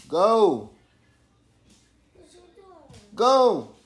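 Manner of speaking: urgent high yell; shouting. Retold or imitated. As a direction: yell it out like it's happening now. Shouting during the shooting story.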